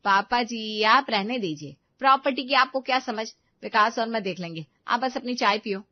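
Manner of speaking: put-on loud, dismissive young wife; rude daughter-in-law. Daughter-in-law dismissing her father-in-law, rude and loud. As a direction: brush the old man off, sharply.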